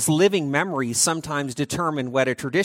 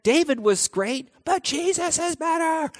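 hyped cheer; 10 semitones higher than usual. Acted. Continuing the hyped comparison.

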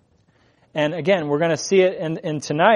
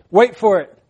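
higher and louder, a knowing tease; teasing wait for it; 4 semitones higher, 5 dB louder than usual. Retold or imitated. Friend who already saw the video, teasing the viewer. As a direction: play the friend who's already seen it. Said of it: "they say".

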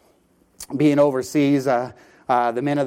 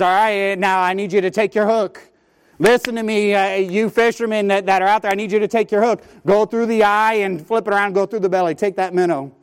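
raised voice of a boat captain giving orders; captain calling out; 6 semitones higher, 4 dB louder than usual. Retold or imitated. Captain to his fishermen, calling out instructions. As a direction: call out instructions to the men on deck.

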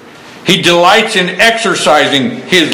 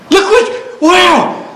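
much higher, awestruck exclamation; amazed first sight; 13 semitones higher, much slower than usual. Acted. Preacher imagining the cured blind man's first look, acted amazement. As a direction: marvel in a high voice, as if seeing for the first time. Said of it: "could you imagine his first look".

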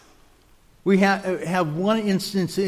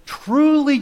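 emphatic truly; 7 semitones higher, 6 dB louder than usual. Retold or imitated. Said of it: "would preface his statement with".

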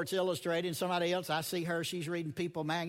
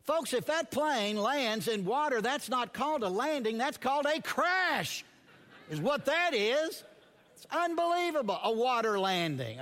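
incredulous shout; 8 semitones higher, 5 dB louder than usual. Real, not acted. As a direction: belt it out in disbelief. Mocking the absurd phrase.

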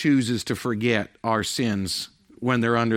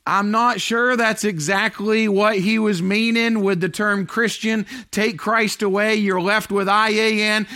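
preaching at full force; 11 semitones higher, 7 dB louder than usual. Acted. Pastor preaching hard to the congregation, full force. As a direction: drive it home from the pulpit.

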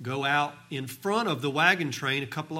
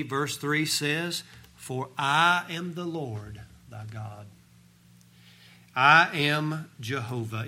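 preaching; 2 semitones higher, 5 dB louder than usual. Acted. Preacher reads God's promises to the congregation, forceful preaching. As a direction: proclaim the verses with full conviction.

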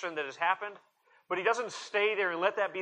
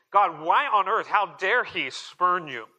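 indignant; 4 semitones higher, 6 dB louder, slower than usual. Acted. Indignant prayer voiced by preacher.

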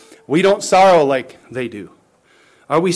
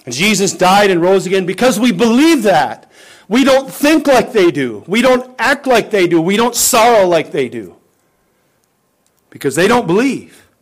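higher, louder rising pulpit cadence; fervent preaching; 6 semitones higher, 6 dB louder than usual. Acted. Preacher contrasting believers with the world, fervent preaching. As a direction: build it line by line like a climax. Building to the sermon's climax.

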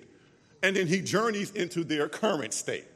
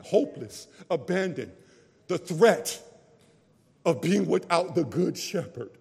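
ordinary talk

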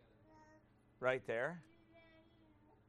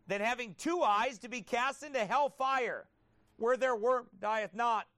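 preaching at full force; 6 semitones higher, 7 dB louder than usual. Acted. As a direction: thunder the scripture out. Preaching Mark nine at full force.

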